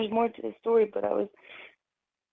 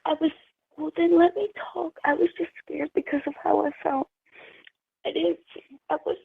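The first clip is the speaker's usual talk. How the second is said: tearful pleading; 6 semitones higher than usual. Real, not acted. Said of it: "Don't give me a fake cry".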